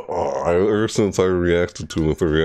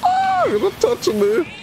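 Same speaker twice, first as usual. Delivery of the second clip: taunting. Real, not acted. Taunting opponent in match.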